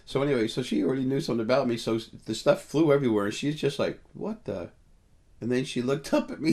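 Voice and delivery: telling it all loud and fast; excited storytelling at the top of his voice; getting loud. Real, not acted. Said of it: "getting kinda loud".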